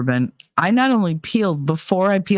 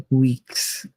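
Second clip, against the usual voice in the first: usual speaking voice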